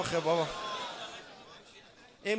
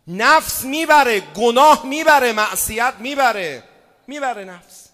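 full-force preaching; 5 semitones higher, 12 dB louder than usual. Acted.

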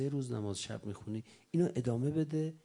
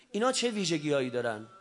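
ordinary talk, nothing marked

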